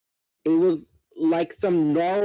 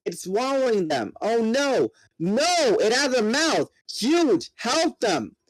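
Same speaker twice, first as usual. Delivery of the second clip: high, raised voice in rising alarm; panicked crying out; 9 semitones higher than usual. Acted. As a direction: cry out in horror as friends are swallowed. Panicked cries in the battle.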